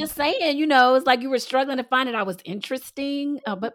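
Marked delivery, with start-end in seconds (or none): 0.0-2.2 teasing loud. Real, not acted. Wife teases back loudly.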